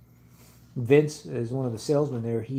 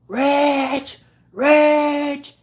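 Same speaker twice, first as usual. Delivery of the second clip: high, loud panicked yell of a name; screaming a name; 8 dB louder than usual. Retold or imitated. Coworker calling for help, frantic yell. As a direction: shout the name across the shop in alarm. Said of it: "And he's screaming".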